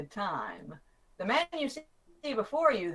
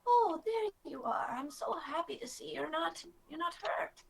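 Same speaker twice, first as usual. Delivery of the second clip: lightly voiced, higher and softer; a relieved, smiling higher voice; smiling Nini; 8 semitones higher, 6 dB quieter than usual. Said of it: "she smiles and she goes".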